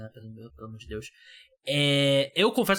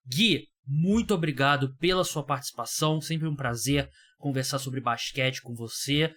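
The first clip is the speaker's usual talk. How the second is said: normal talking voice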